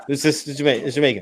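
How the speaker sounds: ordinary talk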